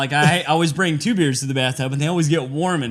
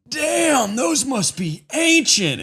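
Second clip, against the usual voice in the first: much higher, slow, drawn-out wonder; mock awe; 10 semitones higher, much slower than usual. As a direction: gawk at it like a naive tourist. Retold awe at carvings.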